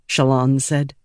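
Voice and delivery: ordinary delivery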